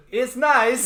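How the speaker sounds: high, loud mock Kazakh Borat accent; Borat impression. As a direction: squeal it like Borat. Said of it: "I went Borat in my head".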